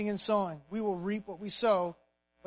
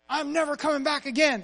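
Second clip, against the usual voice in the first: mock-angry outburst, higher and louder; angry parting shot; 5 semitones higher, 4 dB louder than usual. Patient snapping at the receptionist on his way out, acted anger. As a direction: storm off in a huff, playacted. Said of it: "He angrily pays it and says".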